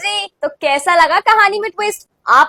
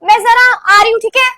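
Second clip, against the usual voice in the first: raised, higher call across the house; 4 semitones higher, 7 dB louder than usual. Acted. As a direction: call to mum on the way out. Calling out to mother on leaving.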